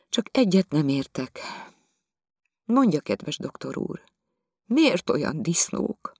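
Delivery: she asks in honest wonder; puzzled lady. Acted. Viziné asks the doctor.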